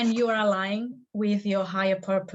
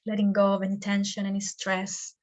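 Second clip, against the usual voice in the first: guided meditation. Acted. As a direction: lead them gently into stillness, stretching the words.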